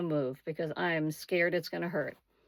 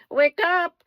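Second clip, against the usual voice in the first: high, loud put-on nurse; 11 semitones higher, 8 dB louder than usual. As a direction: bark it like the nurse. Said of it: "they're just like".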